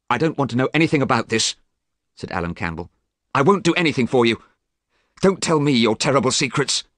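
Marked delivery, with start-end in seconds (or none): 0.1-1.6 fast, higher and louder, pushing him away; angry refusal. Acted. Alan refuses Dorian's plea. 3.3-6.8 fast, higher and louder, pushing him away; angry refusal. Acted. Alan refuses Dorian's plea.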